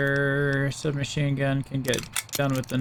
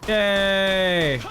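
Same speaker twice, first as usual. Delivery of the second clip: mock cheer; 5 semitones higher than usual. Mock cheer after intro.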